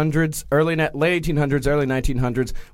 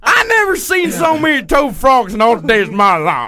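louder, higher folksy witness voice; old Texan witness; 6 semitones higher, 4 dB louder than usual. Retold or imitated. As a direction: marvel like an old local. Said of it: "one witness remembered".

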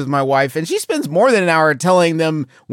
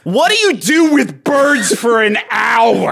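incredulous shout; 3 semitones higher, 3 dB louder, slower than usual. Real, not acted. Incredulous question about bird hour.

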